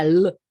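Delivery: "le" with a barely formed schwa vowel; muted French E. Shown as a demonstration. Teacher showing why the vowel is needed, "le" with schwa. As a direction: say "le" as a French "luh". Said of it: "Si no, no podría pronunciar".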